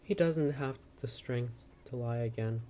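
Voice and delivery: usual speaking voice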